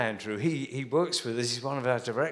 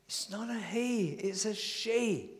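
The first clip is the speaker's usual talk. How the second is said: higher, softer, slow correction; mother correcting; 6 semitones higher, 6 dB quieter, slower than usual. Retold or imitated. Mum correcting son, softer and slow. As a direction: gently set him right once more.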